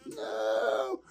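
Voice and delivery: a quick imitated cry of panic; hammed-up panicked no; mock panicked cry. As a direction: play the terrified driver crying no. Driver panicking in retold crash.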